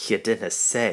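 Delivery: loud mock surprise; exclaiming. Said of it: "he exclaimed".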